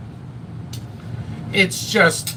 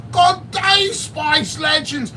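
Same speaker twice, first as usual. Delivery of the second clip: high, drawn-out Aussie greeting; mock Australian shout of good day; Australian accent; 9 semitones higher, much slower than usual. Mocking the Australian reviewer's greeting, in an Aussie accent. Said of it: "that Australian".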